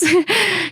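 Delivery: bright high burst of laughter. Real, not acted. Joking to viewers about no podcast seasons, a high laugh. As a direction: laugh at your own joke.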